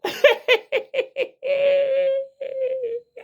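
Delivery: crying with laughter, high; high wheezing laugh. Real, not acted. Teller cracking up at his own punchline, high wheezing laugh.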